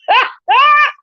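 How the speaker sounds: high burst of laughter; real amused cackle; high, delighted laugh. Real, not acted. Laughing about the neighbours.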